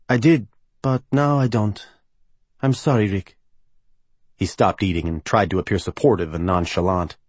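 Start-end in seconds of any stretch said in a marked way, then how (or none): 0.1-3.4 higher-pitched voice for a cheerless woman; Gabriella. Acted. Singer backs out of seeing his game, regretful higher voice. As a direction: let her down him regretfully, pitched up. Gabriella, the unhappy soprano, speaking.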